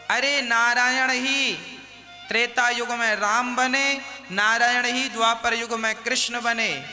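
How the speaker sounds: normal talking voice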